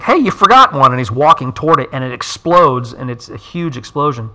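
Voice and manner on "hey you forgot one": higher and louder call. Retold or imitated. Hiccup calls to departing dragons about a left egg, in a raised, higher call. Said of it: "Hiccup's like".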